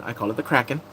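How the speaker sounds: ordinary talk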